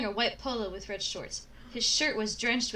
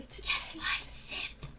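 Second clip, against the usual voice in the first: slow hoarse whisper; Gollum voice; 5 dB quieter, slower than usual. Acted. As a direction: creep it out like a greedy creature. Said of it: "She's like, "Gollum".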